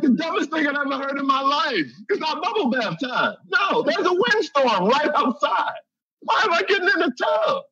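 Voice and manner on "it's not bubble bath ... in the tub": high, shouting disbelief; rising into an outraged yell; indignant outburst. Retold or imitated. Comic ranting at the crowd about the tub advice, high indignant shout.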